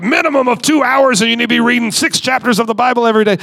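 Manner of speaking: high-pitched mock stern pastor; demanding pastor. Mock pastor lecturing someone on prayer hours, high and insistent. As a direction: rattle off demands like a parody pastor. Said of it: "one more pastor look at somebody".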